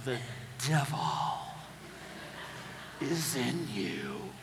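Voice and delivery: mock-ominous parent. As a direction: pronounce it like a dark accusation. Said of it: "And you say".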